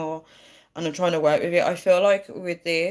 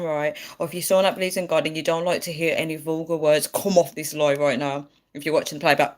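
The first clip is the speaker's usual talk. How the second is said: ordinary talk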